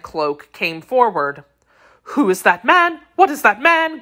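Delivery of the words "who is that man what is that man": high, alarmed questioning, rising in panic; terrified cry. A narrator's reading. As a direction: cry out as if seized by terror. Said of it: "cried out her ladyship, suffocated with terror".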